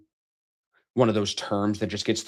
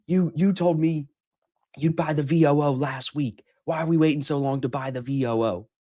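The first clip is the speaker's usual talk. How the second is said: imitating yelling at wife; 6 semitones higher than usual. Retelling how he yelled at his wife about buying VOO, mock yell. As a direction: replay the argument in a higher, mock-angry voice. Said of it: "So I'm, like, yelling at her".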